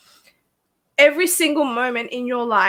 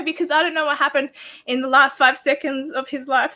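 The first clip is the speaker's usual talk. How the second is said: ordinary talk, nothing marked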